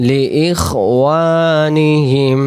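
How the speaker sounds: drawn-out melodic reading; slow recitation. Acted. Teacher reciting for students. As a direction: chant it slowly with held vowels.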